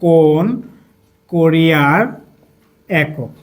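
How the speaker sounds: word by word, drawn out as if writing; slow dictation. Shown as a demonstration. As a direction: stretch each word with pauses between. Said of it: "লিখে রাখলাম".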